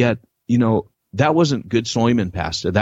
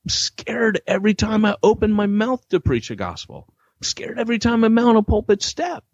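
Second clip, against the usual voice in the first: rising higher with each line; fearful confession, higher as it goes; confessing fear; 11 semitones higher than usual. Retold or imitated. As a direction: pour out the fear, climbing up. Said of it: "I said".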